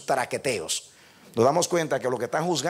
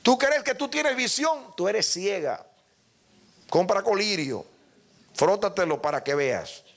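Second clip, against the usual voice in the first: high, stern rebuke; Jesus rebuking; 6 semitones higher than usual. Acted. Jesus to the church at Laodicea, a stern rebuke. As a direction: scold the blind church sternly. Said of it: "Y Jesús le dice".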